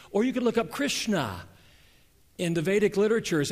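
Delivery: full-voiced sermon delivery; preaching. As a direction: preach it out to the congregation. Preaching the opening.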